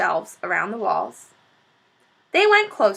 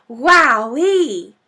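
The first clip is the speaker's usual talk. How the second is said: excited; 3 semitones higher, 8 dB louder than usual. Acted. After gulp of cider.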